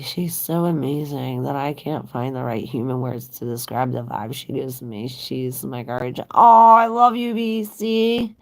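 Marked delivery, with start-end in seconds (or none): 6.3-8.4 high, loud burst of affection; delighted gush. Real, not acted. Thanking a kind viewer, loud gushing love.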